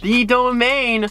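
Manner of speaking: high-pitched, drawn-out disbelief; incredulous customer complaint, each word stretched; exasperated outburst. Retold or imitated. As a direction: spell it out slowly in high exasperation. Acted exasperated outburst at phone agent.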